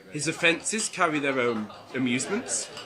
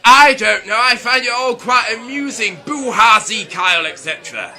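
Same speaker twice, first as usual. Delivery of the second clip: loud; 8 semitones higher, 14 dB louder than usual. Acted. Loud mock-posh outburst.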